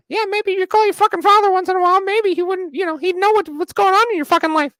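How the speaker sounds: high loud fast hammed-up rant at Madison; mock furious rant. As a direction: yell it like a dad losing it, over the top. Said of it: "I go".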